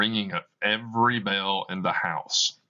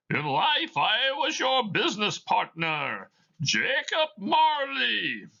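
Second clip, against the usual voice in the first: ghostly Marley voice; 14 semitones higher than usual. Acted. Dead partner answering Scrooge, eerie high ghost voice. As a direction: moan it out like a spirit.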